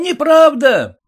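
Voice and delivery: loud high heckling shout; heckler's sharp cry. A narrator's reading. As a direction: yell it from the crowd. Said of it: "крикнул чей-то звучный голос".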